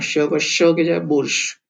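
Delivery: ordinary talk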